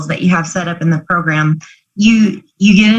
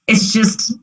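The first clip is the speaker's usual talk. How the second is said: ordinary talk, nothing marked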